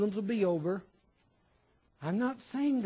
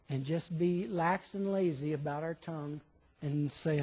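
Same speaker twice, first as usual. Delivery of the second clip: ordinary delivery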